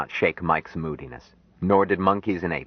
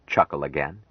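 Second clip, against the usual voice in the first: plain reading voice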